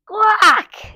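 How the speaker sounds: high sudden cry; startled yelp. Acted. Girl kissed by a boy, high startled yelp. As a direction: squawk in shock. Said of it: "yelled Lacey".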